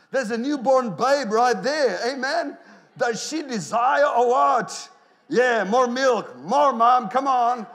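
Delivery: loud, high, drawn-out preaching voice; impassioned preaching. Real, not acted. Preacher pointing out a newborn in the congregation, full-force preaching. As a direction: call it out to the room with full force.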